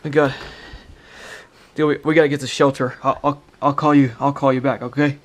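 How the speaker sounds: loud, rushed panic on the phone; panicked. Acted. Friend on phone urging shelter, loud frightened panic. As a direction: hurry the words out in fear. Panicked call as missiles approach.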